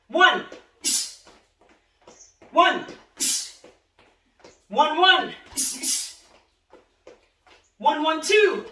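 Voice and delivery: shouted counts. Shown as a demonstration. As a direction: bark each count out to the class. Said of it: "ready, and let's go".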